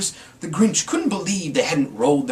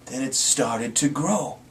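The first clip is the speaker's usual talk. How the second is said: ordinary delivery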